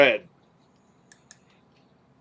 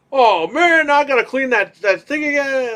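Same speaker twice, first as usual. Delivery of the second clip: a higher, mildly put-on voice of a grumbling cleaner; 8 semitones higher, 3 dB louder than usual. Cleaner complaining about the mess, lightly voiced high whine.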